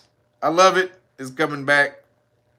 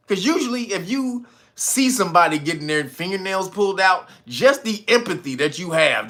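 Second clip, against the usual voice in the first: usual speaking voice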